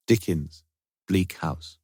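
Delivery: plain reading voice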